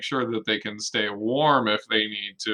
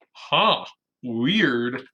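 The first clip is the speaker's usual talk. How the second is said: ordinary talk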